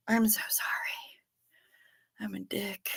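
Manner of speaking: whispered, voiceless apology to herself; guilty whisper. Real, not acted. Apologizing after accidentally timing out a viewer, whispered.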